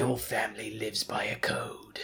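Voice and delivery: a hushed, solemn warrior; Luke Evans voice. Retold or imitated. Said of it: "do your best Luke Evans".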